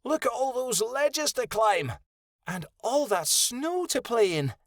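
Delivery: high, excited pup voice; high-pitched, bubbling excitement; bouncy excitement. A narrator's reading. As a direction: bounce along on high, eager words. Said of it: "Lottie bounced".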